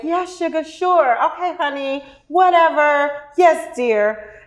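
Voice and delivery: high, sing-song Southern endearments; Southern sweet talk. Retold or imitated. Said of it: "honey sugar baby doll you to death".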